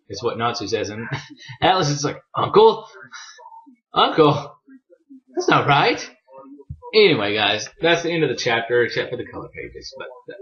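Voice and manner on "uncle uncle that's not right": much higher, louder first uncle, then settling down; a playful double take, as if offended; hammed-up startled dragon repeating uncle; mock shocked disbelief. Atlas reacts to being called uncle.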